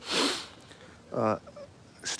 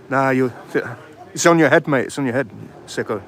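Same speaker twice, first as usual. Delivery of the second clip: angry comeback; 2 semitones higher, 7 dB louder than usual. Real, not acted. Vlogger shouting back at a heckler on the street, angry. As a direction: shout it right back at him. Argument with heckler calling him pervert.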